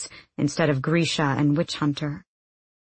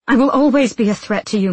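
raised, louder retort; defiant retort; 5 semitones higher, 6 dB louder than usual. A narrator's reading. Woman held by her enemy, defiant retort. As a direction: throw it back at him, voice up.

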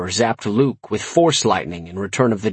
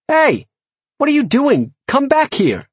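high, urgent call; high-pitched yelling; 14 semitones higher, 3 dB louder than usual. A narrator's reading. Master calling back his fleeing imp, yelling. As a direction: shout after someone, voice jumping way up.

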